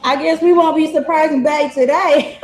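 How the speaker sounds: laughing. Retold or imitated. Girlfriend's reaction, laughing.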